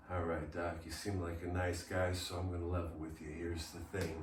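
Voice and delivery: very low, hushed, confiding voice; level with him, low and very soft; Jack Nicholson. Acted. Patient confiding to the doctor about getting winded, low and quiet. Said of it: "Mister Nicholson".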